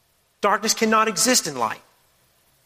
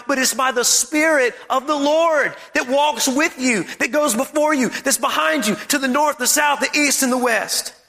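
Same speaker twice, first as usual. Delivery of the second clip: raised, driving proclamation; impassioned preaching; 4 semitones higher than usual. Real, not acted. Preacher assuring congregation of God's power, impassioned. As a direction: declare it with conviction, pitched up.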